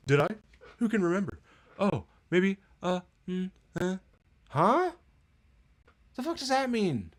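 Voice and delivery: high, airy ditzy voice, going up; sneering at the dialogue with a high mock voice; mocking the character. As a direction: ham up a clueless shrug.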